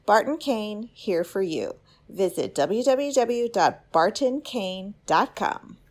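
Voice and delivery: normal talking voice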